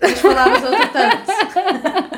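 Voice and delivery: high, slow laughing tease. Real, not acted. Teasing reply with laughter.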